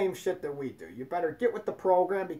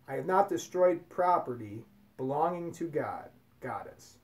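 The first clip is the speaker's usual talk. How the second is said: solemn recitation; 4 semitones lower than usual. Acted. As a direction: recite each vow gravely, leaning on not. Reciting the laws of Ma'at.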